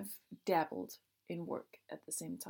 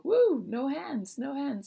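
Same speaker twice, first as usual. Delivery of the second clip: high, loud and drawn-out woo; carefree whoop; 8 semitones higher, 10 dB louder, slower than usual. Retold or imitated. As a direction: shout it like riding a bike hands-free. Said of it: "That's when we go".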